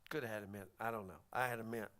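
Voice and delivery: dropped to a near whisper; quiet aside. Real, not acted. Preacher whispering an aside to the congregation. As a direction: confide it under his breath. Aside about having a mint.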